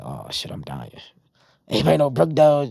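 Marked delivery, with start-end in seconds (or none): 0.0-0.9 much lower and softer, sinking dread. Retold or imitated. Shot man realizing where he's headed, low dread. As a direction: mutter it as if giving up. Hearing he's going to Brookdale.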